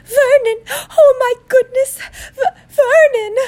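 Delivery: very high, loud wailing aunt; Aunt Petunia. Acted. Horrified aunt cries out to her husband, shrill panic. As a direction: shriek in panic at a very high pitch. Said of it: "That was me being Aunt Petunia".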